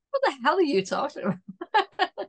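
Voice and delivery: ordinary talk, nothing marked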